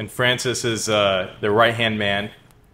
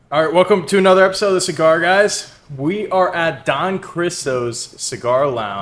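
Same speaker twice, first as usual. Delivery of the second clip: raised, punchy host opening that slows for the venue name; formal show introduction; 6 semitones higher than usual. Real, not acted. Co-host opens the cigar podcast on request, formal introduction. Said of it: "would you like to do a formal introduction".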